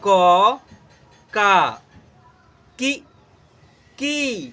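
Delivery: loud, separate syllables, each one spaced with a pause; barahkhadi drill. Shown as a demonstration. Teacher reciting the alphabet chart for students to copy, loud and measured. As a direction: call out each syllable clearly for pupils to repeat. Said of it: "ऐसे उच्चारण करने को practice कीजिए".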